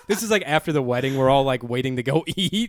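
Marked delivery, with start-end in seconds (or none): none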